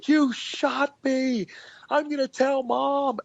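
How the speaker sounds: put-on very high, slow kid's voice threatening to tell; little sister tattling. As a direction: squeal it out like a wronged little girl. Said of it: "she goes".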